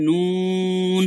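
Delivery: নুন very long, humming through the nose at the end; nun with ghunnah. Shown as a demonstration. Teacher showing the ghunnah, long humming nun. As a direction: stretch নুন into a nasal hum. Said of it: "কিছুটা গুণা হয়ে যায়".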